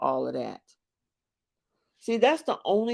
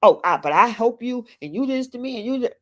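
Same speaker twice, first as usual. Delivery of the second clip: mock whiny complaint; 6 semitones higher, faster than usual.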